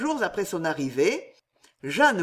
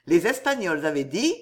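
usual speaking voice

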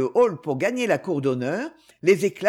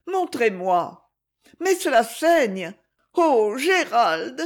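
slow, high, upset reproach; slow rising dismay at the wound; lady shocked by the blood, reproaching Gérald; 5 semitones higher, 3 dB louder, slower than usual. A narrator's reading. Isabelle sees the bleeding wrist.

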